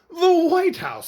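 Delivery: very high, loud, slow amazement; astonished. Acted. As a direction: gasp it out in wonder. Mr Rogers astonished.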